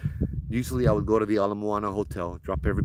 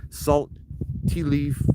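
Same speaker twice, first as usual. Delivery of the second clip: retold yelling; 7 semitones higher, slower than usual. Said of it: "yelling at the top of my lungs".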